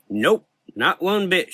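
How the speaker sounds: slow Southern drawl; Southern belle accent. Acted. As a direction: drawl it with sass.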